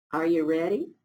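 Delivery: ordinary delivery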